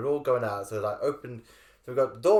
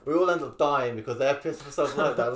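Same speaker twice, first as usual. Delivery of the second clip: usual speaking voice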